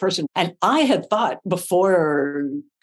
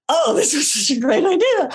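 higher, giggly tipsy glee; giddy laughing; 8 semitones higher than usual. Retold or imitated. Drunk on set, laughing.

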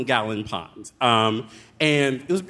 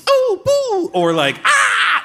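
much higher, louder mock yelps of pain; 7 dB louder, slower than usual. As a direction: squeal like a burned little frog. Imitating frogs' pained cries.

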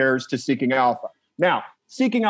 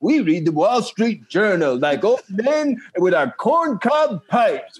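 grumbling out of touch boomer; 6 semitones higher than usual. Retold or imitated. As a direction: play a boomer scoffing at kids. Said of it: "my boomer fucking impression".

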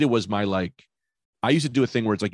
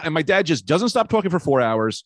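ordinary talk, nothing marked

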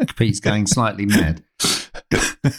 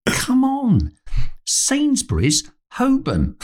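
exasperated stranger; 13 semitones higher, much slower than usual. Retold or imitated. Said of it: "And he went".

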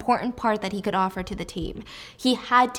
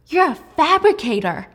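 astonished; 6 semitones higher, 6 dB louder than usual. Retold or imitated. Wylan astonished.

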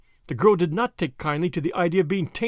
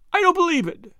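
high, louder pleading moan; 12 semitones higher, 5 dB louder than usual. A narrator's reading. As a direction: moan it out in desperate disbelief. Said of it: "she moaned".

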